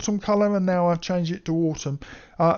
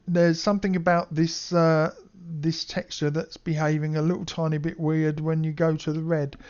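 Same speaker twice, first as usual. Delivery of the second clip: normal talking voice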